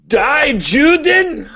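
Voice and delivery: put-on high, drawn-out German accent. Student guessing at a joke in class, questioning German accent. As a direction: stretch it out in a tentative German accent. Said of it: "props for the German accent".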